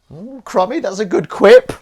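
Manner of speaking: high, loud squeal of approval; playful delight. Pretend. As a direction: coo loudly in a high voice. Playful praise of a quip.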